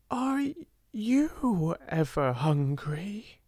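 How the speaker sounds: high, halting, hesitant question; timid, awe-stricken. A narrator's reading. Friend daring to ask about hunger, timid and awe-stricken. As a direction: stammer towards a question you barely dare ask. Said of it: "said in a timid, almost awe-stricken voice".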